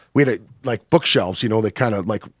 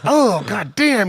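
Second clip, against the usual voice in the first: slow, high drawn-out amazement; mock awestruck exclamation; acted astonishment; 8 semitones higher, much slower than usual. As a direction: stretch it out high, as if stunned. Acting out his stunned reaction.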